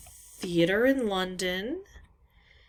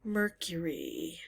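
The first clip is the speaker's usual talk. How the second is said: whisper; 3 semitones lower, 7 dB quieter, slower than usual. Real, not acted. Muttering clue words to herself.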